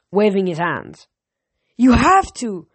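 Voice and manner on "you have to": loud, pleading insistence from a son; insistent shout. A narrator's reading. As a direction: push the line hard, almost shouting at your father. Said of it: "Nico insisted".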